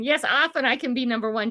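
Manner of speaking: ordinary talk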